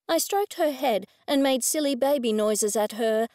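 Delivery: usual speaking voice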